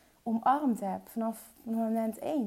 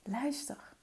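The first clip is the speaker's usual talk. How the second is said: whisper; 10 dB quieter than usual. Real, not acted. Urging the listener to listen.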